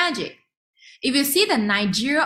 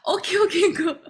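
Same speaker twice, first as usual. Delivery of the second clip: laughing; 9 semitones higher than usual. Retold or imitated. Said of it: "I'm like".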